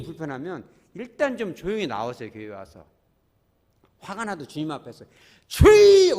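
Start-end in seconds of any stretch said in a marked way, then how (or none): 5.5-6.2 very loud, high prayer shout; crying out to God. Shown as a demonstration. Pastor leads congregation in calling on the Lord, full shout. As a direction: belt it out for the congregation to follow. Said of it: "우리 한번 따라서 하죠".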